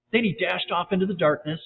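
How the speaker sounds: usual speaking voice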